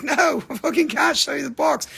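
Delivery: high, loud exasperated retort; laughing, exasperated refusal; exasperated mock reply.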